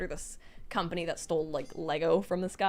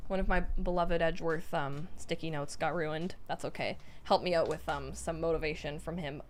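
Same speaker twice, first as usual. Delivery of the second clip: ordinary delivery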